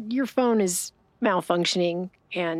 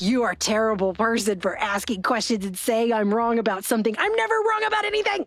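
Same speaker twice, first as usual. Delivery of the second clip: insane chatbot; 3 semitones higher than usual. Retold or imitated. AI snapping at the user who said it was wrong, mock outraged. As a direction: play the AI losing it over being told it's wrong. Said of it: "the A I was like".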